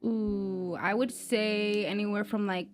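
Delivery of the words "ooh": usual speaking voice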